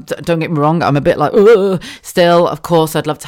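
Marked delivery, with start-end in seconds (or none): 1.3-1.8 exasperated ugh. Real, not acted. Exasperated groan.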